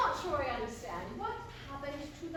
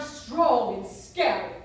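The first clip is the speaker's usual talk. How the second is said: mock forceful; 3 semitones lower, 9 dB louder, slower than usual. Mimicking his strong-and-scary demand.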